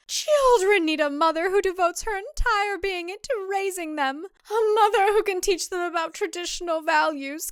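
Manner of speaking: high, earnest mother-in-law lecturing; stern matron voice; preachy older woman. Acted. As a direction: lay down the old traditional values.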